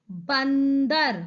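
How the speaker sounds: बंदर very slowly, with a long n; syllable by syllable. Shown as a demonstration. Teacher spelling the picture's name for children, बंदर drawn out. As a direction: hold the n in बंदर before दर. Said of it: "ब के ऊपर अनुस्वार".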